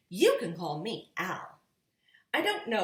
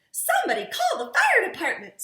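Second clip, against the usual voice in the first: much higher, louder and fast; panicked mother; 11 dB louder, faster than usual. A narrator's reading. Missus Blair urging a call to the fire department, panicky. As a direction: rush it out in a high panic. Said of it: "said Missus Blair".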